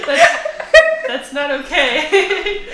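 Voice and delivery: slow, loud and laughing; laughing protest. Real, not acted. Friend protesting the quote, laughing slowly. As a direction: drag it out while cracking up.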